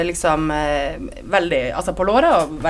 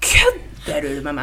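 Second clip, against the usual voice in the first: 1 slow disbelief; 9 semitones higher, much slower than usual. Retold or imitated. Reacting to the crude remark.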